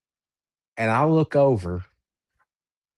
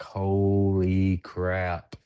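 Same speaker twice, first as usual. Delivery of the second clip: very slow, stretched-out words; awed disbelief; much slower than usual. Retold or imitated. Thinking of the evidence just recorded, slow awed disbelief. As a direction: draw it out as a stunned thought. Retold thought on getting evidence.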